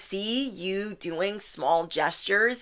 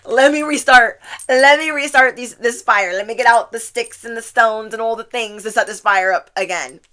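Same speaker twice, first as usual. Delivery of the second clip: loud, high, playful urgency; eager outburst; 3 semitones higher, 7 dB louder than usual. Acted. Reader voices viewer scrambling to relight the flame, eager outburst. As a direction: burst out eagerly, then ease down slightly.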